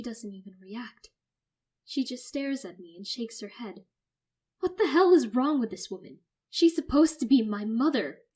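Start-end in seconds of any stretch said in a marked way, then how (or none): 4.6-8.2 loud fury. Acted. Narrator's fury.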